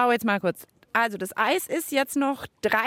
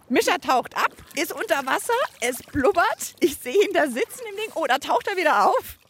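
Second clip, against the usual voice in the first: excited commentary; 5 semitones higher than usual. Acted. Live commentary of the dive.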